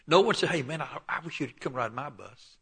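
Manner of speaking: ordinary talk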